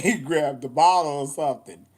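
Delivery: usual speaking voice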